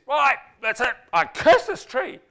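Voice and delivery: high, loud hammed-up outburst; Jesus cursing the fruitless fig tree in mock rage; mock anger. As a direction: blow up in comic fury. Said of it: "needs some anger management".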